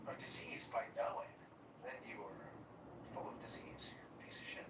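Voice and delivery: unvoiced hushed jab; whisper. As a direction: drop to a whisper for the insult. Whispered jab at S1's point.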